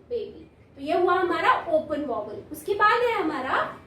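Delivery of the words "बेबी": ordinary talk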